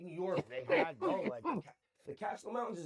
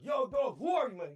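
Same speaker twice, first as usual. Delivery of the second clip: louder, higher DJ voice, a bit hammed-up; hyped radio DJ; 7 semitones higher, 8 dB louder than usual. Radio DJ hyping up the caller, louder and higher. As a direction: play the pumped DJ on air. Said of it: "they'd be like".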